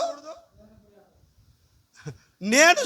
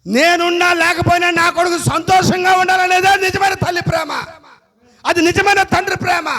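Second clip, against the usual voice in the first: loud, higher proclamation; shouted preaching; 6 semitones higher, 8 dB louder than usual. Acted. Pastor declaring true parental love, shouted preaching. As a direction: call it out as the sermon's climax.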